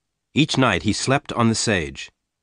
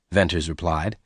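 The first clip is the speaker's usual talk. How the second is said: usual speaking voice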